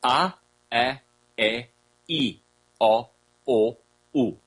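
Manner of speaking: A, E, E, I, O, U spaced out and slow; A, E, E, I, O, U spoken slowly as pure vowels. Shown as a demonstration. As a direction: lay out A, E, E, I, O, U one by one for the recording. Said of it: "let me do it slow".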